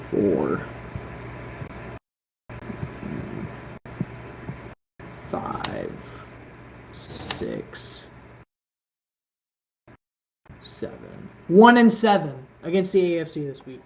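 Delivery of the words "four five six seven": whispered counting, trailing off; counting under breath. Real, not acted. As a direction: count softly to yourself. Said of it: "hold on, I'm, I'm counting this up".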